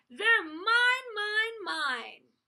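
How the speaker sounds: high, very loud greedy outburst; possessive shouting. A narrator's reading. Minosaur snatching the snacks, shouting possessively. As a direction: claim it all at full voice. Greedy dinosaur grabbing all food.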